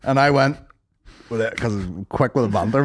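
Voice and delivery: ordinary talk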